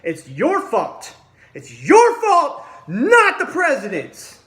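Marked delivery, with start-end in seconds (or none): 1.5-4.4 slow, much higher and louder, with a bitter laugh; furious yelling. Real, not acted. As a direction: scream the blame at them. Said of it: "I'm really pissed off about it".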